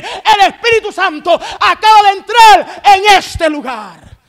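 loud, fervent preaching at full force; preacher telling the online church the Holy Ghost has stepped in; climactic preaching. Acted. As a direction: bring it to the peak.

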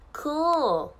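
"cool" with the vowel leaning toward "koh"; Australian-style "cool", rounded gliding vowel; "oo" near "koh". Shown as a demonstration. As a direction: say "cool" close to "koh-ool". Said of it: "こうに近いうの音かな".

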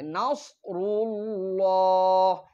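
slow recited নাসরুল্লাহ; tajweed recitation. Shown as a demonstration. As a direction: chant নাসরুল্লাহ slowly with a clear সোয়াদ. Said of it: "তাহলে এটা সোয়াদ দিয়ে".

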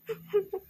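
soft high sob; quiet, breaking sob; short tearful whimper; crying. Acted. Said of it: "ಯಾಕೆ ಅಳ್ತಿರೋದು ನೀನು".

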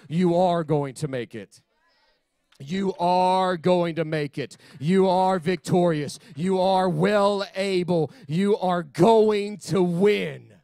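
slow, weighty declarations, building; drawn-out, punched proclamation; emphatic preaching. Real, not acted. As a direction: drive each word home to the congregation. Preaches encouragement to congregation.

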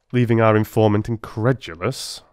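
usual speaking voice

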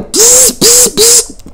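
very high, sharp "psst" blasts like a turbo blow-off valve; turbo hiss noise. Retold or imitated. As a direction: spit out turbo hisses between your lips. Imitating a turbo on the climb.

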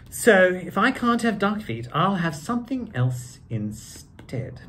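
lively storybook reading; reading to children. A narrator's reading. Actor reading Doctor Seuss to children in a lively voice. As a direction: read it out like story time for kids. Reads book aloud.

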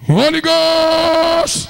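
high, long-held cry; invoking shout. Real, not acted. Preacher calling on the Holy Ghost, high drawn-out shout. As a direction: call it out high and stretched. Leader cries out invocation.

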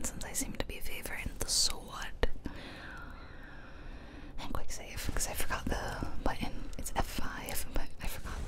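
usual speaking voice

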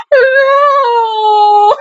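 high sobbing cry of no; real crying protest. Real, not acted. Crying at the song.

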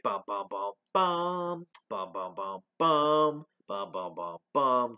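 softly hummed bass rhythm; humming the theme. Retold or imitated. Reviewer humming the opening music, softly. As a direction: hum the movie's theme beat. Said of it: "the music".

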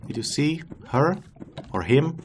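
ordinary talk, nothing marked

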